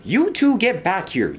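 high, indignant storybook yell. A narrator's reading. Engine yells after two runaways who crashed into her, indignant. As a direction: call after them, pitched high. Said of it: "yelled Madge".

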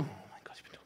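whispered self-reproach. Real, not acted. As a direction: whisper it to yourself. Mutters to herself after failing.

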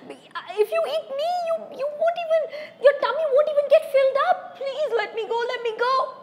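high, laughing, pleading goat voice; little goat begging the troll. Acted. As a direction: beg for your life, squeaky and quick.

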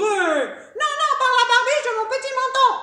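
high, loud, fast piglet squeal of defiance; shrieky little pig voice; little pig refusing. Acted.

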